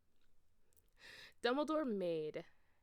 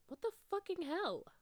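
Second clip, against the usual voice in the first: high, loud angry outburst; 12 semitones higher, 3 dB louder than usual. A narrator's reading. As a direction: spit it out in outrage. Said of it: "I asked angrily".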